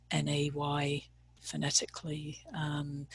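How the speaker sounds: ordinary talk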